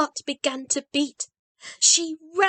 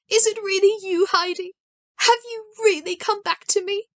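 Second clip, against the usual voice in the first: disbelieving grandmother; 8 semitones higher than usual. A narrator's reading.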